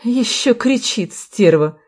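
slow, smug insult; satisfied jeer. A narrator's reading. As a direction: remark with gloating satisfaction. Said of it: "удовлетворённо заметил красноносый". (с довольной издёвкой)

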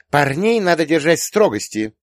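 put-on high, loud strict girl; stern. A narrator's reading. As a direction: cut it off firmly, pitched high. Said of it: "сурово отрезала Юля". (сурово, высоким девичьим голосом)

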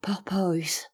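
whispered, barely voiced word; laboured whisper. A narrator's reading. Sick American struggles to utter a word, whispering. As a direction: force one word out without voice. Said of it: "il fit entendre des sons inarticulés".

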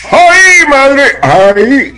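high, loud mock wail in a mother's scarf; campy comic shriek. Kid in mom's scarf clowning for classmates, mock shriek. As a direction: squeal it like a hammed-up TV clown. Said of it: "imitaba a Gordolfo Gelatina".

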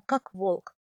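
usual speaking voice

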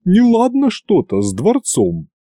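a worried servant voice; Günter voiced. A narrator's reading. Butler warns the tsar about the palace, uneasy.

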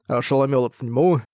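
ordinary delivery

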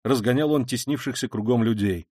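ordinary delivery

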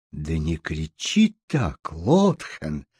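низкий, тихий, медленный голос матери; одёрни дочь вполголоса, низко; недовольное одёргивание. A narrator's reading. Мать унимает визжащую дочь. (устало-тихо, низким голосом)

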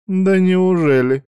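high, slow sarcastic "oh really"; mocking. A narrator's reading. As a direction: tease him, drawing it out up high. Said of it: "издёвка прозвучала от Каори".

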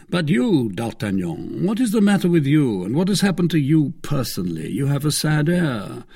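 high, insistent friendly questioning; Athos, probing. Acted. Athos asking D'Artagnan about his sadness, insistent. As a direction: press your friend for the trouble.

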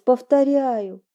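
ordinary delivery

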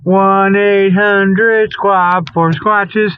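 ordinary talk

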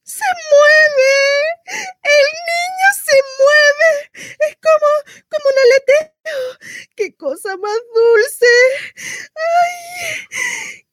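very high, tearful excitement; overcome with wonder. Acted. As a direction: squeal with emotion as the baby moves inside you. Mother feels baby move first time. (emoción llorosa, voz muy aguda)

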